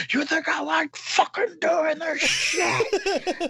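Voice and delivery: high, slow, strained mock yell; drawn-out furious holler, acted out; screaming dad. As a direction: imitate an enraged dad screaming. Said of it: "And he's screaming".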